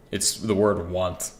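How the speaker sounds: ordinary talk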